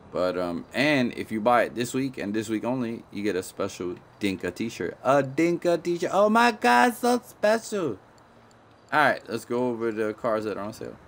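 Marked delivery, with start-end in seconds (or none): 5.0-8.0 high sarcastic squeal, much louder; mock excitement. Gamer mocking the free shirt with a high sarcastic squeal. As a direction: gush over a cheap t-shirt in a high mock voice.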